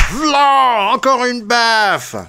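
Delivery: high, drawn-out exclamation; excited slap commentary. A narrator's reading. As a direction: call the blow out high and long.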